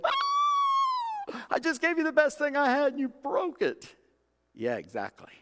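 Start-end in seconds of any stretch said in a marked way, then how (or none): 0.0-4.0 high-pitched wailing protest to God; mock anguished complaint. Believer crying out to God after being broken, high wailing complaint. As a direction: cry out like a wronged child, pitched way up. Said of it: "you go".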